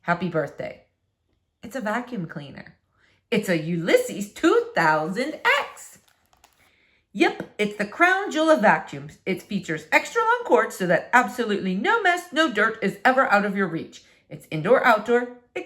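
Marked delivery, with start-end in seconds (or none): none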